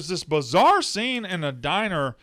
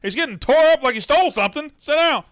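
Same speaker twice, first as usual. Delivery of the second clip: mocking a bratty girl ordering grown men, much higher and louder; bossy bratty daughter; 11 semitones higher, 7 dB louder than usual. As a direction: talk down to the men like a spoiled kid barking orders. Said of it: "talking to grown men like they're idiots".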